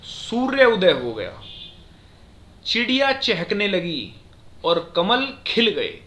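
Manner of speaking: slow, loud reading, long stop after सूर्य उदय हो गया; semicolon pauses. Shown as a demonstration. Teacher demonstrates longer semicolon stops after गया and लगी, slowly. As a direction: halt after गया and again after चहकने लगी. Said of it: "उसके बाद हम रुके".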